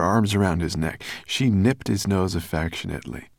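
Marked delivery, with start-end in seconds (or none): none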